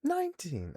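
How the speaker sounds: "nineteen" shot up high and punched; stress on nineteen. Real, not acted. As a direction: hit "nineteen" hard and high. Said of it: "I shouldn't have put emphasis on the nineteen".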